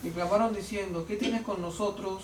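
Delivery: ordinary talk, nothing marked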